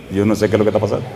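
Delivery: ordinary delivery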